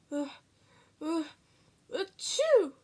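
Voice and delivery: halting uh, uh, then loud sneeze; sneezing. Acted. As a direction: build up and sneeze loudly. Sneeze after build-up.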